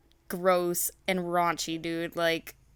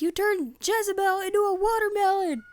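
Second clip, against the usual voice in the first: very high, hammed-up protest; mock outraged wail; whiny upset son; 14 semitones higher, 3 dB louder than usual. Boy Punzel accusing Mom over his sweetheart, mock wail.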